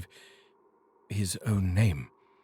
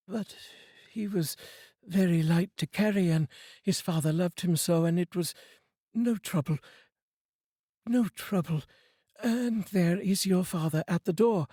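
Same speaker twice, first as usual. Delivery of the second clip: higher, tender mother holding back tears; grieving mother; 9 semitones higher than usual. Acted.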